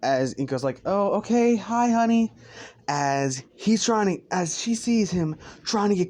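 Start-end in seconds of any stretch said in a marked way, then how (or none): 0.8-2.3 high, light motherly voice; mother's greeting. Acted. Inko greeting her son at home, high motherly voice. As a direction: greet him in a higher mom voice.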